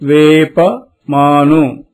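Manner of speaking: low, careful వేప మాను; teacher modelling వేప మాను; model reading. Shown as a demonstration. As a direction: say it clearly for the class to copy. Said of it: "మళ్ళీ ఒకసారి చదవండి".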